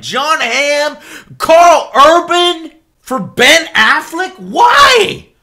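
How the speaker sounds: outraged. Real, not acted. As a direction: demand an answer. Outraged rant.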